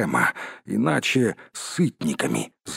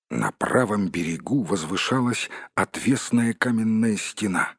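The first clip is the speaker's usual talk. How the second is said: usual speaking voice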